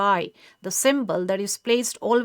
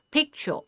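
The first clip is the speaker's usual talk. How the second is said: "picture" with the first syllable higher, falling away on the second; stress on first syllable; 6 semitones higher than usual. Shown as a demonstration. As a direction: stress the PIC in "picture", let the ending drop. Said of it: "first part of the syllable is stressed".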